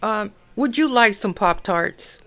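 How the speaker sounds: retold soldier's polite address, loud then hushed; imitating a crisp military voice, lightly done. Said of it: "he said it in a very, uh, military voice".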